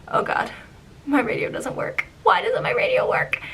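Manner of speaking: rising panicked questioning. Retold or imitated. Skipper panicking when her radio fails.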